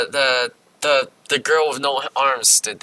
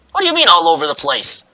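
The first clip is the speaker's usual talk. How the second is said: ordinary delivery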